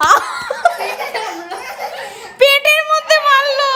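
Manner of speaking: high, slow hammed-up whimper; fake-cry like you've been wounded; mock crying complaint. Mother pretends to be hurt by her child's punch, whining high and slow.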